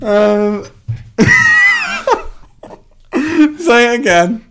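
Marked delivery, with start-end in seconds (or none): 0.7-2.8 high, loud laughing fit; shrieking laugh. Real, not acted. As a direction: burst out in a shrill laugh. Laughing hard at accent.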